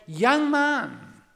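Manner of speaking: imitating a preacher's loud, high direct appeal; retold pulpit exhortation, forceful and emphatic; lay preacher. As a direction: call out the warning, then ease down at the end. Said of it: "he said".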